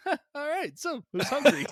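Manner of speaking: high, cheery host voice; bright, oblivious host; cheery Attenborough. Retold or imitated. Imitating Attenborough after the carnage.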